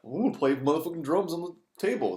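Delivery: loud hammed-up parody; mock dumb guy. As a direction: ham up the idiot's glee. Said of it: "So they gotta, what?".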